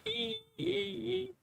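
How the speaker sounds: tiny, very high, soft squeaky meow; cat meow imitation. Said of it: "his little Wolfie meow".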